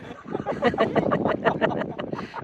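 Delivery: long breathy fit of laughter; laughing. Real, not acted. At the bombardment remark, helpless real laughter. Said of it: "क्यों हंस रहे हो".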